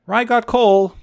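tattling little sister. Acted. Lottie telling their mother on Ry, smug high tattle. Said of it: "Lottie repeated".

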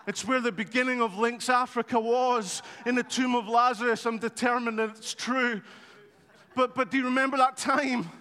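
ordinary delivery

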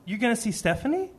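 put-on high child-like question; imitating a young son's question; little boy asking. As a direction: ask like a hopeful small kid. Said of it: "And he says".

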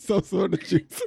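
put-on slow, soft Haitian accent through laughter; mock Haitian accent. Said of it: "I can get into my Haitian bag".

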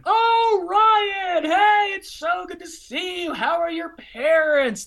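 high, loud, fake-friendly hello; mock cheery greeting. The man who slandered him greeting him, mock cheery. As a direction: ham up his cheesy greeting.

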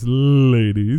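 low sultry purr; sultry narrator. Pretend. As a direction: seductive radio voice. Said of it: "my sultry, sultry voice".

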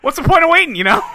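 high, giggling through the words; laughing. Real, not acted. Cohosts cracking each other up over a dark joke, laughing.

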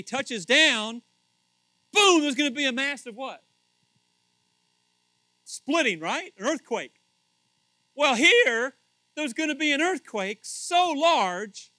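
full-force preaching. Acted. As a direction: boom it out to the congregation.

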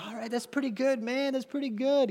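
a lightly voiced laid-back jazz teacher; mellow teacher impression. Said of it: "he's like".

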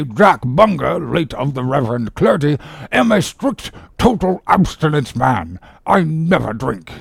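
godly, pompous doctor, pitched high; imperturbable surgeon. Acted. Godly-looking surgeon excuses captain.

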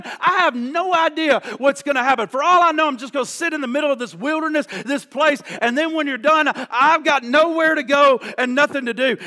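exasperated outpouring; angry at God. Retold or imitated. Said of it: "He was so angry with God".

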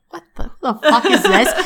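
high, loud, laughing mock bafflement; hammed-up shock at a clingy fan; mock baffled star. Imitating the guest star baffled by an eager assistant, high and loud. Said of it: "And she's like".

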